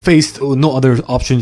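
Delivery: ordinary talk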